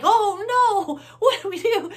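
high, loud mock panic; panicked. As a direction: panic as ducks run under the pedals. Ducks running around the car.

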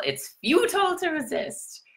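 high, thin alien overlord voice; robotic villain voice. Pretend. Playful villain voice telling the viewer resistance is futile. Sci-fi villain style quote.

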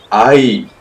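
pained yelp. Acted. Sudden cry of pain.

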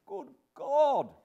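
high, loud despairing cry; desolate desperation. Acted. Husband exclaiming at his wife's folly, desperate. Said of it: "in an outbreak of desolate desperation".

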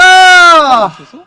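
loud, drawn-out disbelief; surprised shout. Real, not acted. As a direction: shout it slowly in shock. Surprised after fight. (놀라서 길게 외치기)

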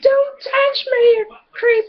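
high, loud mock-hysterical screech; hammed-up high girly scream; mock girl shriek. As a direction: shriek it like a freaked-out game girl. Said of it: "She said".

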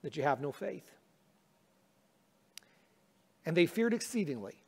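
soft, measured Bible reading; scripture reading. Acted. Pastor reading the gospel to the congregation, hushed. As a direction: read the passage quietly and reverently.

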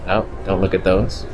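normal talking voice

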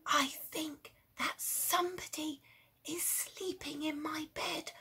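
put-on hushed child whisper. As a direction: whisper so as not to wake him. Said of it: "whispered the little person".

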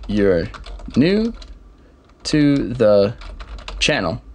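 slow, each word spaced out as you type it; typing along, one word after another; word-by-word dictation. Shown as a demonstration. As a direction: read it out a word at a time while typing. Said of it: "I'm just gonna add the text".

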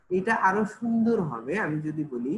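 ordinary talk, nothing marked

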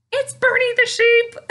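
high, loud delighted exclaim; squealing mock surprise at the sheep; excited surprise. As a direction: burst out in playful surprise. Surprise puppet sheep appears.

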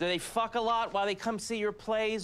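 high-pitched, worked-up interrogation; riled questioning. Real, not acted. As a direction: keep the pitch strained way up. Riled mock questioning about rabbits.

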